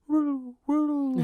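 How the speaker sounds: high, loud wailing siren noise; high sustained siren imitation; imitated police siren. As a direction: mimic a siren with long woops. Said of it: "we just hear".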